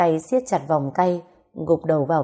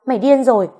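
higher, louder outburst; angry yell; 7 semitones higher, 8 dB louder, faster than usual. A narrator's reading. Woman yelling at the jerk before her, angry yell. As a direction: snap it out as a raised-voice cry. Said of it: "Nàng quát lên".

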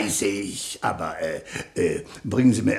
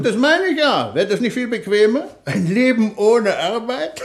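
high, delighted musing on laziness; high teasing glee; gleeful daydream; 10 semitones higher, 6 dB louder than usual. Acted. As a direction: savour the idea of never working. Dreaming aloud of idle life.

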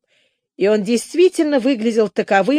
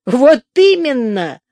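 slow, louder triumphant agreement; delighted emphatic agreement; beaming; 5 semitones higher, 7 dB louder, slower than usual. A narrator's reading. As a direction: radiate pleasure. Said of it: "сияя от удовольствия".

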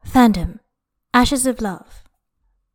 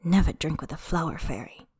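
low, soft rueful mutter; 4 semitones lower, 8 dB quieter than usual. A narrator's reading. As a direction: mutter it under your breath. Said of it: "he muttered".

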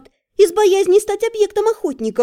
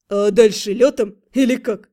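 surprised; 5 semitones lower than usual. A narrator's reading. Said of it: "удивлённо поинтересовался Рент".

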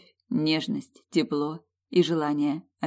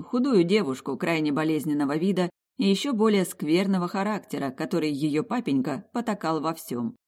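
usual speaking voice

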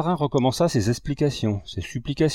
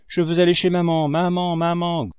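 put-on childish wail; 6 semitones higher, 3 dB louder than usual. A narrator's reading. Boy cries out for his mother.

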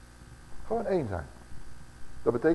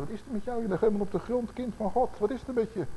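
concerned brother; 7 semitones higher, 4 dB quieter than usual. Retold or imitated. Brother kindly asks the fallen one.

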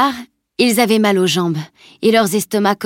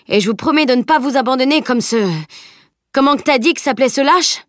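higher, animated hearty carter; charretier bonhomme; 4 semitones higher, 3 dB louder than usual. Acted. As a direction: chat heartily as a friendly carter. (charretier jovial et expressif)

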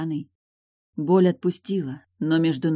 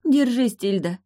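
high, loud desperate plea; imploring cry, easing on the name; pleading; 8 semitones higher, 6 dB louder than usual. A narrator's reading. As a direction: beg a friend to hold on. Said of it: "взмолилась Эйлин".

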